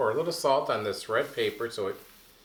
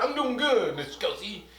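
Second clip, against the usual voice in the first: high little puppet voice; J J answers Mister Kelsey; 8 semitones higher than usual. Acted. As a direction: play a shy puppet.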